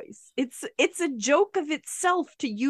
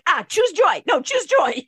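loud, raised-pitch mimicry; hammed-up loud mocking echo; mock insistent retort; 5 semitones higher, 4 dB louder than usual. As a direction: throw the slogan back loudly. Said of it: "And I'm like".

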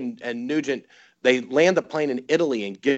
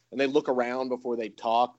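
normal talking voice

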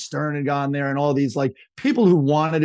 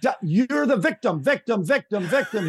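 high, insistent mock yell; pitched-up imitation of a phone rant; retold yelling; 7 semitones higher than usual. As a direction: hammer the repeated word like an exasperated boss. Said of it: "like, yelling on his speakerphone".

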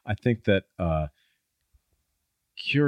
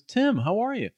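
cheery greeting; 14 semitones higher than usual. Retold or imitated. Old assistant now studio president greets.

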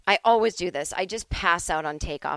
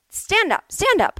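high, loud imitation of an insistent flight attendant; insistent urging; 13 semitones higher, 7 dB louder than usual. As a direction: urge them to their feet, louder and higher. Attendant acted out, giving orders.

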